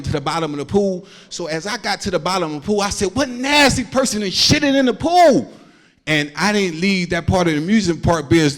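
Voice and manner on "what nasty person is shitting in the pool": mock outraged yell. Outraged shout underwater.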